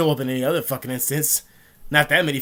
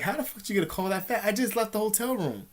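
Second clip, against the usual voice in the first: higher, incredulous retort to the cop; mock outrage, pitched up; retold disbelief; 7 semitones higher, 3 dB quieter than usual. Disbelief at cop's fast call.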